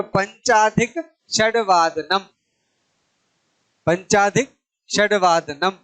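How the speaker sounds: slow, building the compound piece by piece; slow demo. Shown as a demonstration. Teacher building a Sanskrit time word slowly for the class. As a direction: join the parts slowly so they can follow. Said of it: "ये क्या हो जाएगा?".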